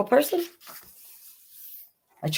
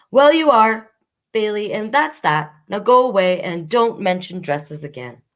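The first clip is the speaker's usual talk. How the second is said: higher, louder firm dismissal; stern mother; 5 semitones higher, 4 dB louder than usual. Acted. Mother sending Bailey away, louder stern dismissal. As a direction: close the topic like a strict parent.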